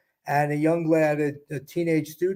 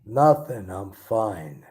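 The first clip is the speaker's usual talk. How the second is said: tense and withdrawn; 8 semitones lower, much slower than usual. Acted. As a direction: brush it off, hiding the hurt. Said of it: "so we're gonna try this, a bit tense".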